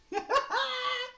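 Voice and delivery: shrill burst of giggling; high squealing laugh. Real, not acted. Laughs at his own French.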